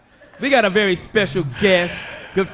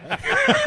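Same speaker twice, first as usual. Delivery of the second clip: a sudden high-pitched laugh; high burst of laughter. Real, not acted. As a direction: crack up high. Big laugh at joke.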